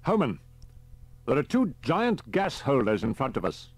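captain voice. A narrator's reading. Peters on radio.

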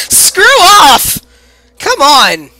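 high loud yell; angry yelling. Real, not acted. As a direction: yell it away. Yelling at monster.

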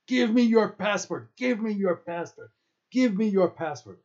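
high, loud repeated angry demand; shouting. A narrator's reading. Guard knocking camper to the ground, disdainful shouting.

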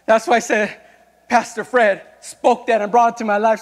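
loud, high, fervent preacher's cry; full-force preaching. Acted. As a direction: preach it out to the whole church.

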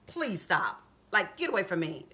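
low, quiet mock dismissal; disdainful. Goliath brushing off young David, disdainful. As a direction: wave him off, softly and wearily. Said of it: "like, he disdained him".

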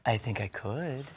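slow, low and hushed; soft, drawn-out radio croon; soothing radio voice. Acted. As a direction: answer like a soothing late-night DJ. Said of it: "talk in that soothing voice".

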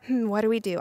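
small, subdued worry; acted anxious murmur; quiet fear. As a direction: fret under your breath. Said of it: "and they were scared".

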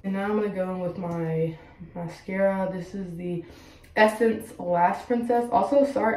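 normal talking voice

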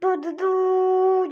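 normal talking voice

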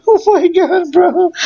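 high, giggly exclamation; laughing. Real, not acted. Player laughing at his own bad luck in battle. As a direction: squeal it through laughter.

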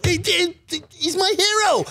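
high, dopey hero worship; dopey fan voice. Acted. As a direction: gush like a dim fan. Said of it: "my dopey fan voice".

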